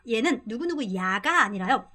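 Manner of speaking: normal talking voice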